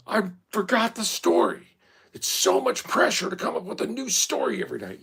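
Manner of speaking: high, put-on whiny parent voice; mock frazzled parent. Parent complaining to kids about bedtime stories, whiny put-on voice. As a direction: whine like a tired parent at bedtime.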